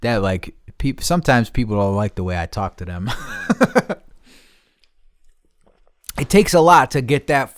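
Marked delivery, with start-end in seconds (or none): 3.1-3.9 high wheezy laugh; breathless giggle. Real, not acted. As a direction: crack up at your own remark. Laughs at own remark.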